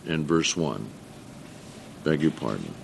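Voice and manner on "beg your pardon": lower, softer beg your pardon; quiet self-correction; sheepish apology. Real, not acted. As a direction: drop it low, a little embarrassed. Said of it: "I just reminded".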